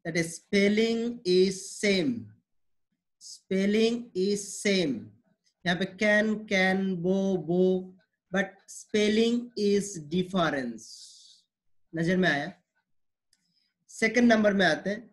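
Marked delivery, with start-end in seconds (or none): 0.1-4.9 slow teaching emphasis. Shown as a demonstration. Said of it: "what I have said". 8.3-10.9 slow teaching emphasis. Shown as a demonstration. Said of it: "यहाँ पे मैंने क्या बोला आपको".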